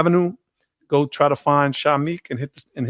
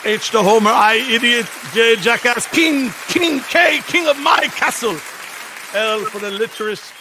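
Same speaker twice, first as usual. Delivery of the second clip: put-on Jamaican accent that drifts a little Irish; Jamaican dad, slipping Irish; 6 semitones higher than usual. Drunk Jamaican dad scolding by the alphabet, imitated tirade. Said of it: "it did get a little Irish there".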